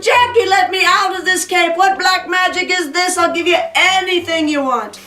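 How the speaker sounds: high, pleading cry; desperate devil. Acted. Trapped devil begging Jack, high and desperate.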